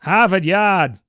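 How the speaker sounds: loud hammed-up Boston Harvard Yard; teasing back in a Boston accent; mock Boston accent. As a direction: drop the r, Hahvahd Yahd. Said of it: "you brought in a Boston accent".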